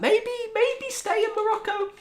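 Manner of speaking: very high, squeaked hint; squeaky suggestion. Retold or imitated. Advising the boss who lives half-year in Morocco, squeaky. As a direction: squeak it as a comic nudge. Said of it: "And I was like".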